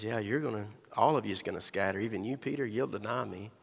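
low and soft, heavy prediction; somber Jesus. Acted. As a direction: say it quietly, with weight. Jesus predicts their scattering.

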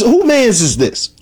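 "Who mans is this?" asked high and slow in disbelief; incredulous. Retold or imitated. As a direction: drag out the question like you can't believe it. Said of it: "I'm like, I'm thinking".

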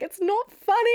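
high-pitched, put-on tearful protest; mock upset wail. Crying daughter objects to dad laughing, mock wail. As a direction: whine it as if crying at being laughed at.

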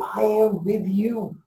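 soft, slow, hushed reassurance; softly voiced as an imitated whisper, drawn out; God's gentle whisper. Preacher voicing God's whisper to the congregation, hushed and slow. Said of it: "He whispers, saying".

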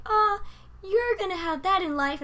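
high, sing-song mock of the women at work; mocking coworkers. Imitating women at work, high and chirpy. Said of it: "or they're like".

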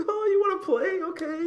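very high, loud kiddie-show host; hammed-up falsetto funhouse glee; Firefly Funhouse voice. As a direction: squeal it like a playful puppet-show character. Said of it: "a Firefly Funhouse thing".

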